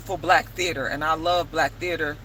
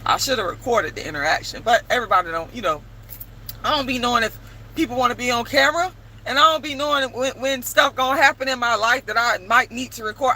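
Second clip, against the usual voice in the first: normal talking voice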